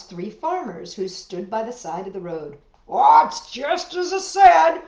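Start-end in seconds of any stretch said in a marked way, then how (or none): 2.9-4.8 loud, high outburst in a farmer's voice; loud know-it-all exclamation; exclaiming. Acted. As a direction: blurt it out as a righteous farmer. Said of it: "one of the three exclaimed".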